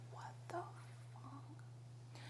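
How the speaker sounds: whispered disbelief, trailing off almost to nothing; very soft, stretched-out shocked whisper; stunned whisper. Retold or imitated. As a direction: whisper it, as if too stunned to speak up. Stunned reaction to being told off.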